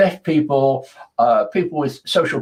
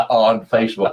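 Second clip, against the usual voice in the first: ordinary delivery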